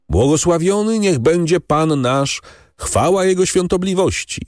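high, ringing ceremonial announcement; głośna proklamacja. A narrator's reading. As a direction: proclaim it clearly, pitched well up. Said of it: "zawołał głośno i wyraźnie". (głośno i wyraźnie, uroczystym wysokim głosem)

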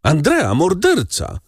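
high-pitched alarmed exclamation; woman's cry. A narrator's reading. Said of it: "krzyknęła jedna z kobiet". (wysoki okrzyk przerażenia)

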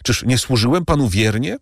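despairing cry. A narrator's reading. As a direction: beg your master not to do it. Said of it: "zawołał w rozpaczy".

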